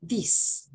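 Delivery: THIS said short, with a brief i; short-vowel this. Shown as a demonstration. Teacher demonstrating singular "this" to students, short vowel. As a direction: keep the i in "this" short and single. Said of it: "la pronunciación de estas palabras".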